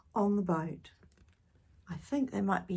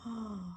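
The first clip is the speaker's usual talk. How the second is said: gasp; 2 semitones higher, 10 dB quieter than usual. Acted. Excited aside to children.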